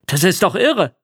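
loud burst of enthusiasm; excited call. A narrator's reading. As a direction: call it out, thrilled and astonished. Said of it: "rief Papa mir zu".

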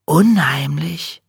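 slow, breathy whisper. Acted. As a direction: breathe the word out slowly. Maja, hushed and eerie.

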